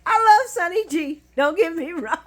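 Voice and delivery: high, loud, still cracking up; real laughing apology. Real, not acted. Fan teased Sonny's looks, apologising through real laughter.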